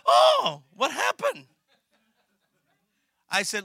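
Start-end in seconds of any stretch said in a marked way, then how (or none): 0.1-1.5 high, loud mock astonishment; loud, hammed-up surprise. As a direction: feign shock, teasing. Pastor mocks sudden change.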